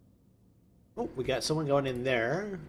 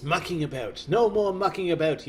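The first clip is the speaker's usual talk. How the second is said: badly faked British telling-off; mock British accent; 3 semitones higher, 3 dB louder than usual. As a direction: put on an amateurish British accent. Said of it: "British accent".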